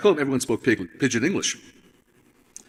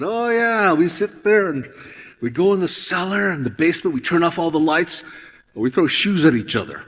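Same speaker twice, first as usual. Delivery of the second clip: excited retelling; 5 semitones higher, 6 dB louder than usual. Retold or imitated. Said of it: "And I said".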